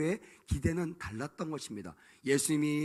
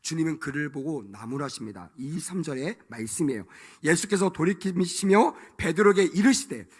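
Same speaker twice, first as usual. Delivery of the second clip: normal talking voice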